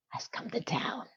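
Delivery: hushed, very soft whisper; dramatic whisper. Real, not acted. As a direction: whisper it for suspense. Drops to whisper for effect.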